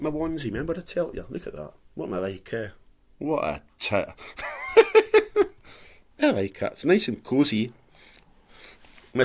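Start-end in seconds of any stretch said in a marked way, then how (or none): 4.1-5.5 squealing high laugh. Real, not acted. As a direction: burst into a shrill giggle. Laughs at own onesie.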